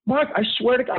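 high, pleading protest; young employee pleading with his boss, high-pitched. Retold or imitated. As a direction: beg him to believe you. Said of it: "in tears at this point".

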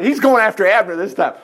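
normal talking voice

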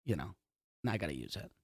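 talking from far off the microphone; away from the mic. Real, not acted. Guest drifting off the podcast mic, sounding far and faint. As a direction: lean back so the voice drops away from the mic. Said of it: "Look, I'm using the mic now".

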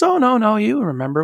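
high, swooping imitation of mom brushing it off; dismissive denial. Mom answering her child's doubt about dad, dismissive denial.

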